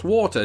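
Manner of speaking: water as "waw-tuh"; British water. Shown as a demonstration. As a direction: say "water" with an aw and crisp T. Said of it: "I pronounce it".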